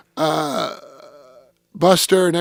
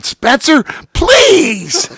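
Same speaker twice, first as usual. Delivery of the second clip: higher, louder mock plea; pleading Buster; 8 semitones higher, 5 dB louder, much slower than usual. Buster begging Spencer not to fight, mock plea. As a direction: beg him to stop, a bit hammed-up. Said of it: "He goes".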